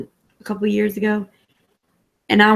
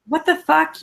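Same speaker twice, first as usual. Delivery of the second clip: surprised; 8 semitones higher than usual. Real, not acted. Surprised at no accent.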